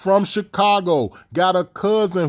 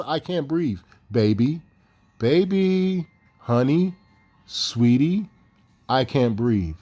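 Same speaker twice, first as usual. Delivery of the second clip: mock calm plea; 7 semitones lower, slower than usual. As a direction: imitate her cooing sweet names while being choked, too composed. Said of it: "she's gonna be able to talk like that".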